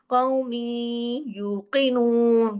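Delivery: very slow chanted recitation; Quran recitation. Shown as a demonstration. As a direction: recite with idgham, drawn out. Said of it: "काफ मोटा". (धीमी तजवीद वाली तिलावत)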